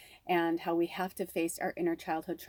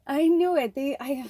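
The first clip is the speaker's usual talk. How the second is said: high, cracking voice as tears come, louder; breaking into tears; 10 semitones higher, 6 dB louder than usual. Real, not acted. Remembering grandma's last words, she bursts into high crying. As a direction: voice breaks as she starts to cry over grandma. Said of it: "Oh, I'm gonna start crying".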